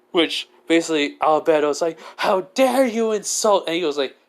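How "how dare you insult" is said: high-pitched offended outburst; hammed-up outrage; indignant. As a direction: act affronted at the insult. Offended character snaps at insult.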